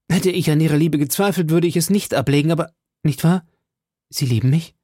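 D'Artagnan lightly voiced, hurried and hopeful; eager pleading. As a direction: rush to secure her love.

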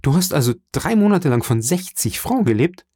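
high-pitched, incredulous question; appalled disbelief. A narrator's reading. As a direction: press the amount in dismay. Son appalled at father's poverty. (fassungslos und erregt)

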